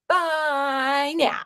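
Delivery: drawn-out playful bye now, stretching now; sing-song bye. Pretend. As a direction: sing the farewell in a cutesy voice.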